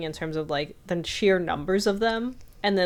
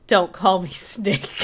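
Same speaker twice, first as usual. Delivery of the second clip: gruff hero line; 4 dB louder, slower than usual. Retold or imitated. Quoting the hero as he coldly refuses a nickname, slow and gruff. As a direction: drag it out like a brooding action hero.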